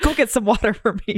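hammed-up irritable healer; mock angry. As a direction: boss them about, half laughing. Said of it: "angrily mashing them together".

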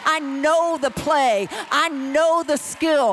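loud, driving sermon delivery as a fired-up David; impassioned preaching. Acted. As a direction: preach it like a pumped-up player on game day.

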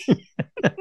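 high, loud, real laughing fit; cracking up. Real, not acted. Host cracks up at who wrote that line, laughing. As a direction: squeal it out through helpless laughter.

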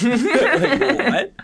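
high squealing laugh. Real, not acted. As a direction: crack up mid-word. Laughing after impression.

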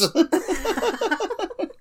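rising laugh. Real, not acted. Laughing at imagined kid.

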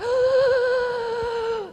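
loud, high, drawn-out gasp; imitated sobbing gasp. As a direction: mimic an inconsolable child's huge gasp. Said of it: "they just start shaking, they're like".

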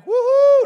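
loud high-pitched whoop; sudden piercing celebratory cheer; crowd cheering. Acted. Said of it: "y'all remember they're crying".

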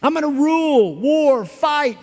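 high, slow, swaggering tough-guy voice; mock bully. Preacher mocks the culture's might-is-right boast, high swaggering. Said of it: "this culture where it's like".

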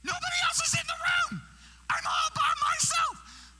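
very high, loud wail of protest; exasperated shout. Acted. Guest speaker left alone in the room, exasperated shouting. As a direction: cry out at being abandoned.

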